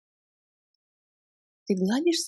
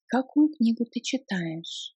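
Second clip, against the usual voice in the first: ordinary talk, nothing marked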